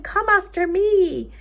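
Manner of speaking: loud calling shout, slow and high. Acted. Boy shouting for the creatures to follow, loud call. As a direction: call out to the whole wood. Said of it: "shouting and calling".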